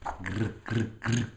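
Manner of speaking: short growls of claws; monster growl. Acted. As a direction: growl like a clawing beast. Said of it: "showed their terrible claws".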